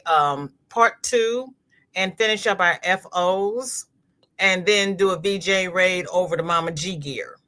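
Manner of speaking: usual speaking voice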